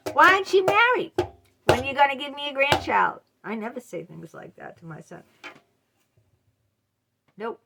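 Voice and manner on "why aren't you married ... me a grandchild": high, loud, fast mock nag; nagging parent. Parent nagging adult child about marriage, hammed-up high nag. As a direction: play the pushy parent demanding a grandchild. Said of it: "your parent says".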